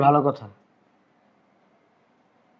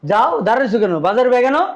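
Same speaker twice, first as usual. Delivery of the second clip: raised, much higher, louder command; impatient angry order; 9 semitones higher, 6 dB louder than usual. Acted. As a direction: bark the order at her. Husband losing patience, repeats order. (রেগে জোরে হুকুম)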